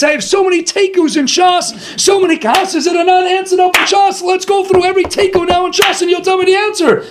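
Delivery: hammed-up madman firing questions; mock shouted challenge.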